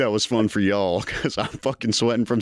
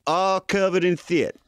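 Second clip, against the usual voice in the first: lisping boxer voice, higher; Mike Tyson; 6 semitones higher than usual. Acted. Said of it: "Mike Tyson".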